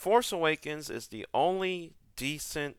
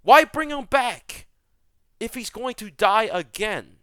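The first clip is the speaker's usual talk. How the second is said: exasperated yell; 6 semitones higher, 8 dB louder than usual. Real, not acted. Griping to viewers about the sequel, in a loud outraged question. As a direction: demand an answer in disbelief. Ranting about Palpatine dying again.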